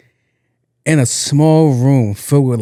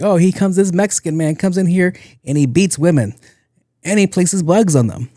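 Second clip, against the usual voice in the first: higher-pitched imitation of a complainer; mock accusation; 6 semitones higher than usual. Coworkers accuse him to HR, mock accusation. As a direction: lightly act out an outraged report.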